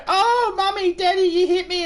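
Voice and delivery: hammed-up crying child voice; whiny kid. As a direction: play the wounded kid tattling to the parents. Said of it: "and you go".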